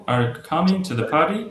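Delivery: slow, COMING stressed, PARTY rising; stress and intonation. Shown as a demonstration. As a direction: keep "to the" light, punch COMING and lift PARTY. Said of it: "ছেদা হালকা করে ফেলতে হবে".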